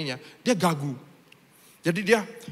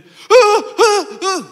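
mock mute grunts; 14 dB louder, much slower than usual. Mute man answers grunting.

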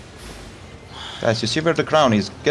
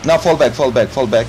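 shouted callout; 10 semitones higher, 6 dB louder than usual. Real, not acted. Shotcaller calling a fight.

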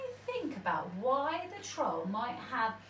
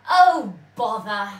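loud grumbling troll voice; disappointed troll; 11 dB louder than usual. A narrator's reading. Troll finding a spider not a goat, loud grumble. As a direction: boom out a grumpy letdown. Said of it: "said the troll".

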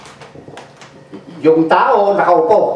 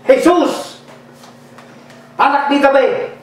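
high calling cry, a touch of pleading; retold cry; 5 semitones higher than usual. Blind beggar calling to Jesus in Tagalog, high cry. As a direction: call to Jesus from the roadside, pitched up. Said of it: "Ang sigaw niya ito".

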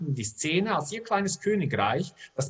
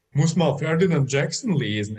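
mock pompous know-it-all, louder; a hint of a mocking smartass; mocking imitation; 6 dB louder than usual. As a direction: ape the guy's smug tone, louder. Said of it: "äffte Ursel den Typen nochmal nach".

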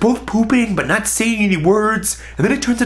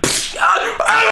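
high-pitched "psh, ah, ah" yelps; imitated bump and yelp; slower than usual. Guy hits his head on towel dispenser, mimicked high yelps.

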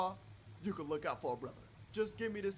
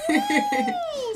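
mock surprise; 12 dB louder than usual. Mock teasing at customer.

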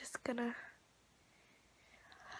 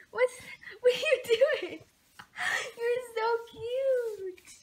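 high cooing; 8 semitones higher than usual. Real, not acted. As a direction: gush over the puppy. Cooing at dog.

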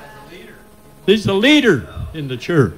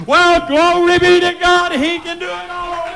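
very high whooping cry of glory; ecstatic praise shout. Real, not acted. Preacher whoops praise to God before the congregation. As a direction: let the praise burst out of you.

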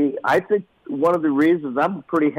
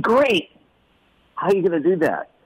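a higher bright Great!; enthusiastic dad; 6 semitones higher than usual. Retold or imitated. Father encouraging his son, higher and cheerful. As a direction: cheer him on, then challenge. Dad's upbeat challenge.

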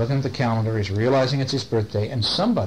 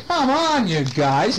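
higher mock exasperated plea; exasperated Sammy; 6 semitones higher, slower than usual. Storyteller voices Sammy opening endless boxes, exasperated. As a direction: plead lightly, starting high and dropping. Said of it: "And Sammy is going".

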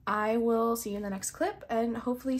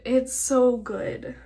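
slow, much louder gushing; excited repeat; 2 semitones higher, 10 dB louder, much slower than usual. Real, not acted. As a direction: say it again with a burst of fan excitement.

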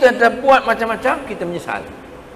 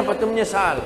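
usual speaking voice

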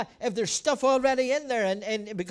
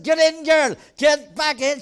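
retold urgent shout; 3 semitones higher, 7 dB louder, slower than usual. Pastor retelling shouting at his wife Laura, urgent. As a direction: yell at her to get back in. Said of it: "I said".

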